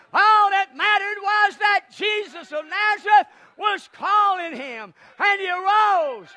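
high, loud sermon shout; booming, drawn-out preacher's cry; full-force preaching. Real, not acted. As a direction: drive the point home from the pulpit.

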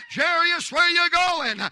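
loud, high call across the street; calling out to Jairus. Retold or imitated. Bystander to Jairus, loud high call. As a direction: shout his name after him. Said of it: "I hear somebody say".

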